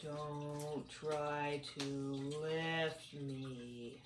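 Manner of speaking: low, soft, very slow drawl; slow snail voice. Acted. The snail greets the friends, low and very slow. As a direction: speak like a sluggish snail. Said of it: "said the snail".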